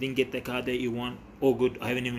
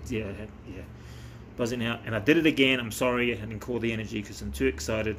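usual speaking voice

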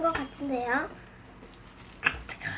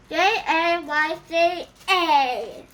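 slow spelled out; 2 semitones higher, 4 dB louder, much slower than usual. Acted. Spelling out channel name.

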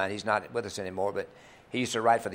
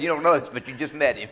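laughing punchline; 5 semitones higher, 5 dB louder than usual. Retold or imitated. Punchline quote, laugh heard.

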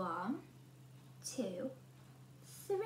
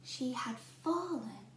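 ordinary delivery, nothing marked

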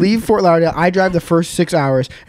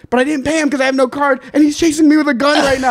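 high, fast nervous rush; high hurried panic; panicky friend; 9 semitones higher, faster than usual. Retold or imitated. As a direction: rush it out, pitched up. Friend explains the chase.

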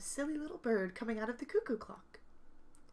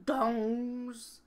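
plain reading voice

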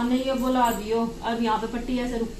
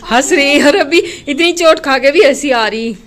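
amused exclaim; 3 semitones higher, 12 dB louder than usual. Real, not acted. Mother exclaims child laughing.

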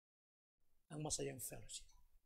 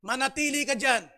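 stern father; 7 semitones higher, 12 dB louder than usual. Acted. Father refuses again.

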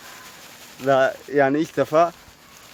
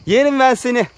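squealed affection, pitched way up; doting pet talk; 10 semitones higher, 9 dB louder than usual. Real, not acted. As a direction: gush over an adored animal.

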